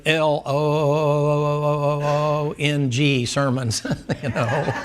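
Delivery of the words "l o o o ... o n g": each letter of "L O O O O O O N G" slowly stretched out; drawn-out long. Retold or imitated. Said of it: "and he put".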